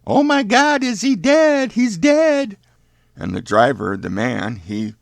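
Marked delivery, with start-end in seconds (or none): 0.1-2.6 loud, very high screaming woman; Marcia Meadows. Acted. Lady passenger sees the body hit, hysterical high screaming. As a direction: wail it out in a high-pitched put-on lady's scream.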